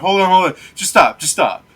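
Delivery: urgent hold on. Acted. Player voiced calming the gunman.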